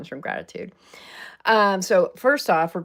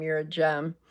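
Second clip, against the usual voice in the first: usual speaking voice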